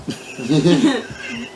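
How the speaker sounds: pained embarrassed laugh; rueful chuckle. Real, not acted. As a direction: laugh at your own cringe. Laughing at own old writing.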